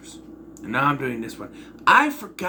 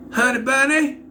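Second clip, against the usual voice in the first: high, loud, hammed-up Southern accent; mocking exaggerated Southern squeal; Elizabeth Banks's overdone accent; 11 semitones higher, 5 dB louder than usual. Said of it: "sounds like she's just doing too much".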